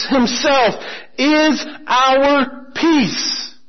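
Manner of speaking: very slow, each word weighted; solemn slow refrain. Acted. Preacher repeating the key verse, very slow and solemn. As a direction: let the verse land slowly.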